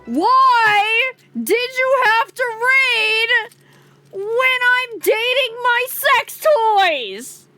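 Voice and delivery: high, slow playful whine; mock whine. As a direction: whine it, teasing the raiders. Mock complaint at raiders.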